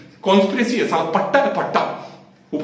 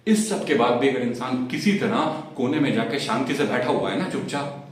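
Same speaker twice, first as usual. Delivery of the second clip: ordinary delivery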